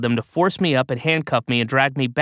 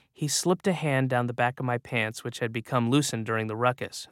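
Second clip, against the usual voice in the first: ordinary delivery, nothing marked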